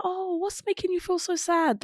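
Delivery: gentle concern. Shown as a demonstration. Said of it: "you can still be like".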